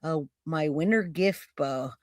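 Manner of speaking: quiet, puzzled repeat of the words; mulling over a strange phrase, low and soft. Real, not acted. Host rereads a viewer's odd comment, puzzled and quiet. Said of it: "A what?".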